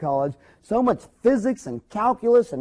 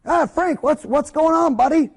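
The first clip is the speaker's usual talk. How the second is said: hearty greeting; 8 semitones higher, 4 dB louder than usual. Retold or imitated. Dentist greeting Frank.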